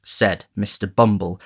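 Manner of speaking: ordinary delivery, nothing marked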